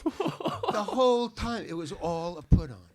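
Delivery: words stretched out through real laughter; slow, giggly realization; laughing. Real, not acted. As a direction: laugh as the prank dawns on you. Laughing at the prank reveal.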